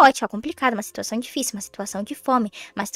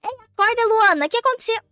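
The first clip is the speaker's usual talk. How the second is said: mais agudo e alto, perguntas afobadas; susto alarmado; 7 semitones higher, 3 dB louder than usual. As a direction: acorde em pânico achando que é ladrão. Filha acorda assustada com ladrão. (filha assustada chamando a irmã Luana)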